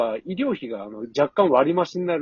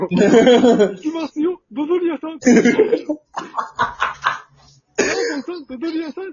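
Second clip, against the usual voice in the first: anime villain impression; 11 semitones higher, 8 dB louder than usual. Anime voice imitation, laughing.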